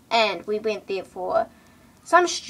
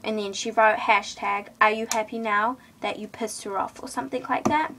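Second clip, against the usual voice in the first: ordinary talk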